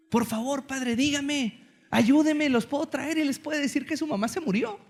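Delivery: widowed father begs the priest for help, pleading. Acted. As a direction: beg the priest for help, voice rising.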